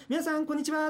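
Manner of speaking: high, projected event-host greeting; event MC call. Shown as a demonstration. Event MC greeting a crowd, big lifted voice. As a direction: throw it out across a big venue. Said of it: "ちょっと大きな声でね".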